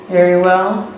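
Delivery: normal talking voice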